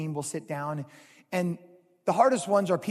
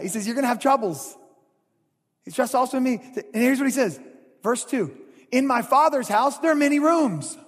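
preaching; 4 semitones higher, 6 dB louder than usual. Acted. Pastor preaching at full force.

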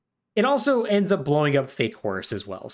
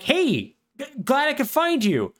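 high voiced fake friend; 11 semitones higher than usual. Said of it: "And he's like".

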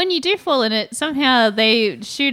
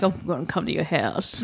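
lower, softer ominous threat; mock menacing threat; 6 semitones lower, 6 dB quieter than usual. Showrunner threatening a critic, lightly menacing. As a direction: threaten quietly like a movie villain. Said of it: "Maybe be like".

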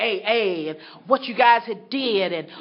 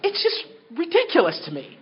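ordinary talk, nothing marked

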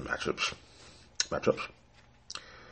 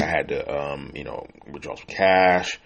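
ordinary talk

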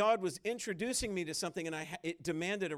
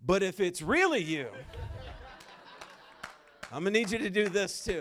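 louder, higher mock haggling; bargaining with God; 4 semitones higher, 8 dB louder than usual. Preacher acting a believer bargaining with God for proof. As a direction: play it as a cheeky demand. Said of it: "and you're like".

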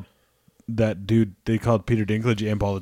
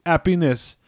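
"happiness" with silent H, higher; French accent happiness; 6 semitones higher than usual. Shown as a demonstration.

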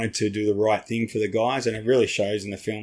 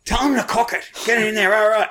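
mock urgent command; 11 semitones higher than usual. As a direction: urge them on, pitched high. Urging Tim.

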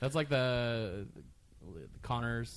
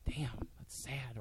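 whispered; 9 dB quieter, slower than usual. Retold or imitated. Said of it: "I'll be like".

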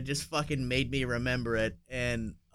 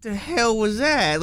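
higher, slow mock-startled realtor; baffled realtor; 8 semitones higher, 4 dB louder, slower than usual. Retelling the realtor's reaction, higher slow mock bafflement. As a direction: ham up the confused realtor. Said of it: "the fucking realtor literally went".

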